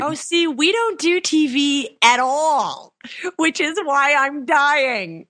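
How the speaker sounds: high, drawn-out comic wail; exaggerated high-pitched exasperation; mock despair. Real, not acted. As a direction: stretch it out high, playing up the suffering. Mock despair over no TV rule.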